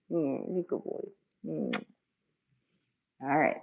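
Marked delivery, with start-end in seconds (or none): 0.1-1.8 hushed praise for a pet; quiet cooing; soft praise. Real, not acted. Praising the cat.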